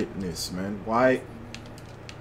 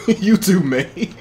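laughing; 11 semitones higher, 4 dB louder, slower than usual. Real, not acted. Laughing at enemy players.

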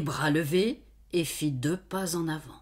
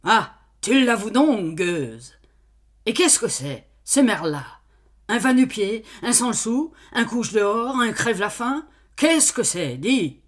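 loud, high scornful tirade; enraged master berating the maid; sputtering rage; 5 semitones higher, 6 dB louder than usual. Acted. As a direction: spit out the insults in anger. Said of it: "bredouillait de colère".

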